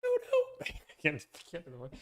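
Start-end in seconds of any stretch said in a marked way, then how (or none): 0.0-0.6 much higher, quick squeaky no; high squeak. Real, not acted. Streamer backing out of the quote, high squeak.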